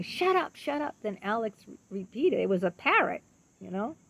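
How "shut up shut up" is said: high, abrupt mock outburst; imitating aggressive child. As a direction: mimic a child parroting an aggressive phrase. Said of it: "He was acting aggressive".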